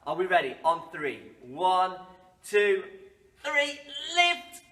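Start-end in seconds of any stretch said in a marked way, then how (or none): 1.4-4.6 building count to lift. Pretend. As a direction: count up then heave.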